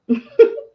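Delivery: short, loud giggle; amused chuckle. Real, not acted. Host joking about spelling her website, short laugh. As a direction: laugh briefly at your own joke.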